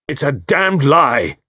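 angry cry. Acted. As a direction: fling the denial back, slowly. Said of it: "cried Carter".

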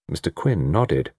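ordinary delivery, nothing marked

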